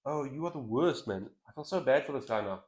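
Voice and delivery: usual speaking voice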